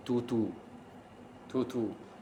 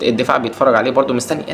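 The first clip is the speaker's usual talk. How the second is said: normal talking voice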